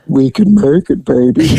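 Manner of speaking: mushy, mouth-full imitation of Charles; mumbled Charles voice. Officer fakes Charles's mumble to fool the lookout. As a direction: mumble like your mouth is stuffed. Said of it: "Charles sounds like he has a pile of shit in his mouth".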